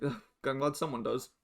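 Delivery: ordinary talk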